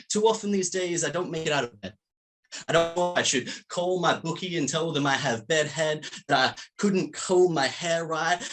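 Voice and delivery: measured spoken-word recitation of a poem. Acted. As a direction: perform the poem to the room, unhurried.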